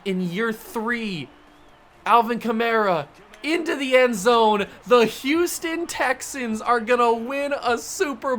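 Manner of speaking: loud, thrilled play-by-play yelling; excited shout. Real, not acted. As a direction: shout it out in disbelief. About to win Super Bowl.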